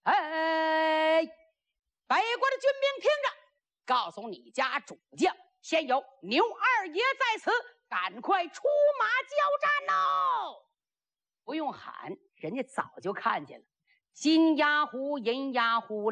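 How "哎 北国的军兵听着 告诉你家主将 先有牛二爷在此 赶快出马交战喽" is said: hammed-up herald's cry, endings held high; yelled challenge. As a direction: shout across to the enemy camp. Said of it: "二十个人一起喊".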